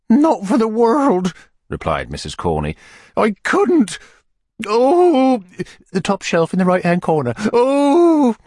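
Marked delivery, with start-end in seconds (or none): 0.1-1.3 high, slow falsetto refusal; overwrought lady. A narrator's reading. Widow refuses the beadle's wine, high overwrought voice. 3.2-8.4 high falsetto with loud wailing ohs; spasms of distress. A narrator's reading. Lady points to the cupboard, wailing through spasms. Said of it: "convulsion from internal spasms".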